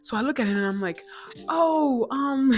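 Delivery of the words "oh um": high, loud nervous laugh through the words; flustered laugh. Retold or imitated. Flustered retelling, laugh heard.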